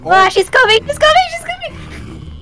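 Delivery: panicked yelling. Real, not acted. Friend panics as monster approaches.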